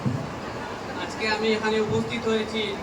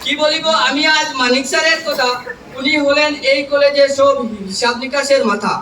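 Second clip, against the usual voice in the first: loud, measured public recitation of verse; poem recitation; 2 semitones higher, 7 dB louder than usual. Acted. Trainee reciting a farewell poem to college teachers, declaimed loudly. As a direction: declaim rhymed tributes line by line, pausing between phrases.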